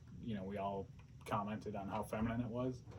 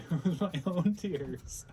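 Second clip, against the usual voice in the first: laughing while repeating the line; 9 semitones higher, 9 dB louder, slower than usual. Retold or imitated. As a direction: laugh through it.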